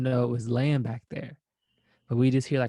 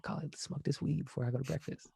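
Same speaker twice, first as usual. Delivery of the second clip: hushed early-morning call; 3 semitones higher, 9 dB quieter than usual. Retold or imitated. Waking Kali early to smoke before breakfast, hushed. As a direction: keep it down, it's six thirty.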